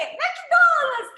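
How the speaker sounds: put-on high, loud, gleeful child reading McDonald's; imitating a child excitedly reading McDonald's off a sign; child reading a logo. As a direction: squeal McDonald's like a proud kid spotting the sign. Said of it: "Ela lê".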